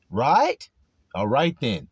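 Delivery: ordinary talk, nothing marked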